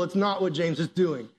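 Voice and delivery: ordinary talk, nothing marked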